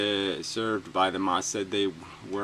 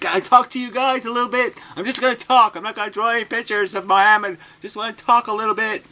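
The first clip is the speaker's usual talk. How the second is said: much higher, louder, nervous mock voice; timid liaison; 12 semitones higher, 8 dB louder, faster than usual. Mocking the mayor's liaison approaching Muslims, high and timid. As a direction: play a scared go-between. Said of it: "he goes up to the Muslims, like".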